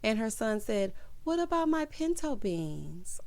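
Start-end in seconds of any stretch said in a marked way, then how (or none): 1.2-3.2 put-on high, soft, drawn-out little kid voice; child's plea. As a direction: imitate a small child asking plaintively. Voices sad child in retelling.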